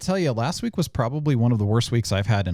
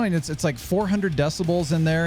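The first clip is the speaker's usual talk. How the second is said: ordinary delivery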